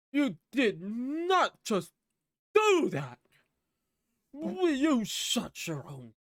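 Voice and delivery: high, slow, drawn-out indignation; mock outrage. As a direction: splutter like a hammed-up wounded villain. Shot villain's outrage, acted out.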